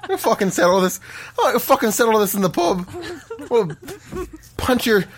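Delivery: pub-brawler Irish brogue; mock-menacing Irish accent. Shown as a demonstration. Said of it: "that's how we Irish folks".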